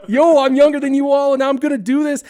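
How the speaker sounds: high, loud triumphant crowing; cocky boasting. Acted. Airborne kid brags to older boys, loud high crowing. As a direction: holler it like the champion of the hill.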